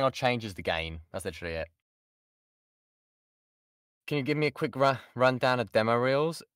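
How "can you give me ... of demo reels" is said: ordinary delivery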